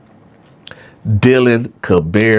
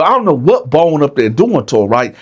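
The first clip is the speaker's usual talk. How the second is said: ordinary talk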